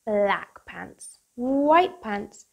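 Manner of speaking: bright, bouncy storybook read-aloud; lively picture book voice for kids; reading to children. Acted. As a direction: read it like bedtime story time for little ones.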